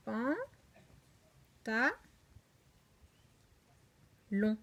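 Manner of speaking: "Pan", "Ta", "Long", each alone with long pauses; separated teaching syllables, waiting after "Pan" and "Ta"; isolated syllables. Shown as a demonstration. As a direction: count out "Pan. Ta. Long." one beat at a time. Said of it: "Voilà, trois syllabes".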